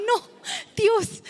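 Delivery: high alarmed no, Dios; panicked cry. Retold or imitated. Recalling the open door, high panicked cry. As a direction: cry out in sudden dread.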